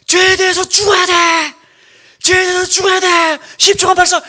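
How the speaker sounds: shouted drill instructor commands; loud gung-ho soldier. Pretend. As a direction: bark it like an army drill instructor. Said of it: "바울이 군대 조교처럼".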